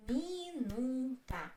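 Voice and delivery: "Minuta" slowly, in three separate syllables. Shown as a demonstration. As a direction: stretch out mi, nu, ta in "Minuta".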